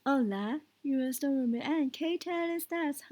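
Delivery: Spanish spoken with an English accent. Shown as a demonstration. Spanish teacher showing learners the trick, English-accented Spanish. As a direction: imitate an English person trying out Spanish. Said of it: "the English accent".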